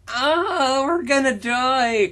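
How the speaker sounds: high, slow hammed-up panic; mock scream. Reading Chris's panic as a high, slow mock scream. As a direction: wail it like a melodramatic kid. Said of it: "Chris screamed".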